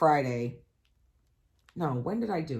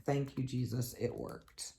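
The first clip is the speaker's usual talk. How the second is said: relieved whisper; 4 semitones lower, 10 dB quieter than usual. Real, not acted. AC finally works, relief.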